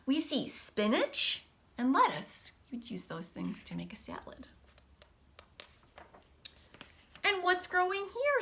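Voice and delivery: lively storybook read-aloud voice; picture-book reading with big pauses; reading to children. Acted. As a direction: read it brightly to a class of kids. Teacher reads picture book aloud.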